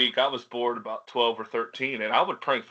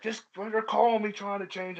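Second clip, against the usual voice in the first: retold griping, pitched up; pissed-off dad; 8 semitones higher than usual. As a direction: imitate an annoyed dad griping. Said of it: "he'd come home pissed".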